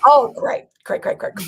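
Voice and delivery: high, mock-exasperated repeated great; sarcastic great. Real, not acted. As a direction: rattle off the greats in playful annoyance. Partner yawns mid-rant.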